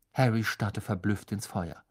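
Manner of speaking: ordinary talk, nothing marked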